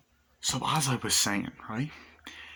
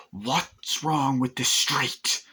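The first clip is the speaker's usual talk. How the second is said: mock slow question; 6 semitones higher, 3 dB louder, much slower than usual. Man questioning construction worker.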